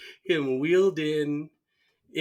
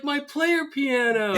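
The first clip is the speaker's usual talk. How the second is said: high, louder damsel wail; mock distress cry; 12 semitones higher, 5 dB louder than usual. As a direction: imitate a woman wailing for rescue. Retold woman crying for her piano.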